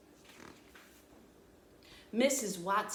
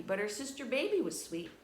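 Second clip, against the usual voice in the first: ordinary delivery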